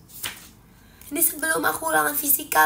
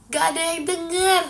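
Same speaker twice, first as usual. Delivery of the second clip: higher, louder, drawn-out complaint; straining to be heard; 5 semitones higher, 6 dB louder than usual. Real, not acted. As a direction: push the voice up in frustration. Complains nobody hears her strained voice.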